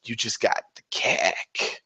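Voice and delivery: low, soft, slow leering voice; creepy mock gloat. Demon players taunting opponents: low leering creep voice. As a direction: leer it out like a creep. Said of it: "we're like".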